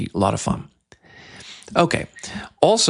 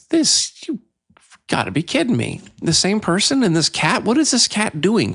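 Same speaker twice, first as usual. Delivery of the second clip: high-pitched, exasperated incredulity; playfully baffled, pitch jumping up; mock disbelief; 8 semitones higher than usual. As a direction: react as if you can't believe this cat. Acted baffled reaction to cat text.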